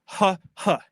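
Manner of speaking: "ha, ha" as two separate breathed H syllables; isolated H sound. Shown as a demonstration. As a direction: sound out the alliterating H in "ha". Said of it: "we get H".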